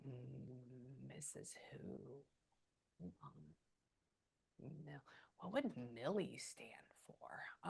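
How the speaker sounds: a slow, unvoiced whisper to herself; whispered aside. Real, not acted. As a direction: whisper the question slowly, thinking aloud. Medium musing over a name.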